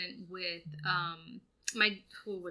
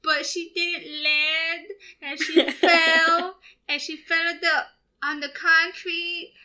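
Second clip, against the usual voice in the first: put-on much higher, slow, crying kid's voice; whiny son; 8 semitones higher, 8 dB louder, slower than usual. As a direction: whimper it out like a guilty little boy. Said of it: "He's like".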